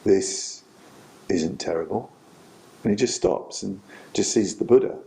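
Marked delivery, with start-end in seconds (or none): 0.0-2.1 low, calm voice with long pauses; quiet, measured reassurance out of the dark; voice from darkness. Retold or imitated. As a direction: answer softly and low, unhurried. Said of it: "this voice comes from the dar-, darkness".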